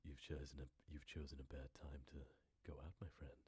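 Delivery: low, hushed, creepy American voice; soft, menacing American accent. Shown as a demonstration. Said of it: "I can do, like, a creepy American".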